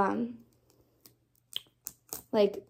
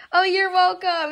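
louder, tearful and moved; touched crying; 5 semitones higher, 9 dB louder than usual. Real, not acted. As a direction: say it choking up with joy. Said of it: "I'm literally gonna start crying".

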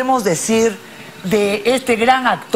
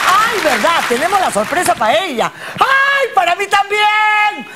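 excited shriek; 9 semitones higher than usual. Real, not acted. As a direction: squeal with sudden excitement. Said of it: "perdón que grite".

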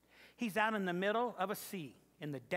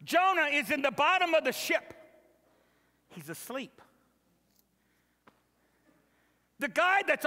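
loud, high preacher's call; full-force sermon delivery; fiery preaching; 7 semitones higher, 6 dB louder than usual. Acted. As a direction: preach it to the back row.

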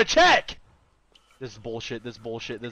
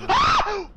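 scream; 9 dB louder than usual. Real, not acted. Startled by voice.

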